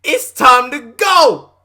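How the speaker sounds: high, drawn-out mock-panic yell; comic alarmed shout. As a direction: shout it out slowly, playing up the alarm. Comic alarm at scary story.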